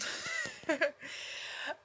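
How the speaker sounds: high giggle at her own clumsiness; embarrassed laugh. Real, not acted. Laughs after dropping phone.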